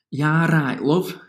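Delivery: northern English. Retold or imitated. Imitating grandma's greeting, nice northern English tone. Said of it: "northern tone".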